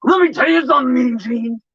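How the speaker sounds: gravelly wrestler promo shout; Hulk Hogan voice. Pretend. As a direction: cut a wrestling promo, brother. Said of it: "I don't even sound like Hulk Hogan".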